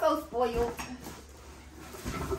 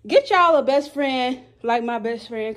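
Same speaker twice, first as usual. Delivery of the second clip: ordinary delivery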